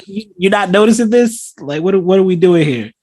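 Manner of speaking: high-pitched incredulous questioning; mock disbelief. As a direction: play someone amazed nobody else has noticed. Acting out alarmed disbelief.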